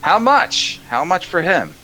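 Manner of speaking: high, pushy gnome calling out; high gnome shouting an offer for the horse; eager gnome shout. Acted. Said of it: "One of them shouts".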